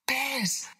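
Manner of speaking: put-on very high little-boy exclamation; child's alarmed cry. A narrator's reading. William suddenly thinks of bears, alarmed child's cry. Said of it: "shouted William".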